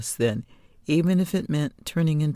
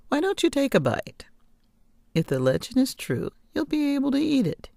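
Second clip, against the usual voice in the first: put-on teasing old woman; 11 semitones higher, faster than usual. Witch taunts girl.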